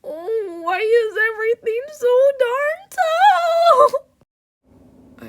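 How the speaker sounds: high, loud, drawn-out cartoon wail; wailing clown. Little clown lamenting a tall world, loud high wail. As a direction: bawl it out in hammed-up despair.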